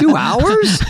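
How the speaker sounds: very high-pitched disbelief; high, incredulous retort; incredulous yelp. Retold or imitated. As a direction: squeal it in mock outrage. Retells Chris Farley's outraged reaction.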